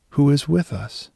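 ordinary talk, nothing marked

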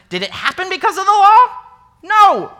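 mock-incredulous teacher; sarcastic. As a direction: answer your own question with a sarcastic snap. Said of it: "read this with a hint of sarcasm".

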